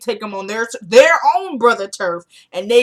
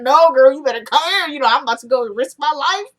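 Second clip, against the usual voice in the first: higher and louder, cocky come-here; strutting swagger; 6 semitones higher, 5 dB louder than usual. Retold or imitated. Husband pulling his wife in for a kiss, cocky swagger. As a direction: strut it, all swagger and charm. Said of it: "you know, strut".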